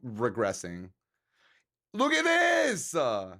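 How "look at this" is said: much higher and louder, slow; excited shout. Real, not acted. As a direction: show off the art in awe. Excited about fan art.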